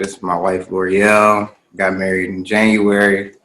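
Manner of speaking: usual speaking voice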